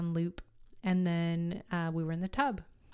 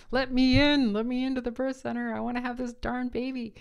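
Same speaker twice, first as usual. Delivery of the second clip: loud, high, pleading demand; imagined outburst; 6 semitones higher, 6 dB louder than usual. Retold or imitated. To the midwife, a loud high unspoken plea. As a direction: let it burst out loud and high. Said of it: "I just wanted to say".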